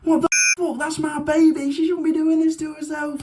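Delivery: high, mock horrified parent; shocked parent. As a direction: shriek it like an appalled mother. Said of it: "then they'd be like".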